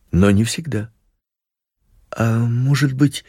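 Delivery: ordinary delivery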